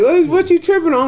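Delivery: high, challenging; confrontational. Retold or imitated. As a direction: call him out high. Roller confronting in story.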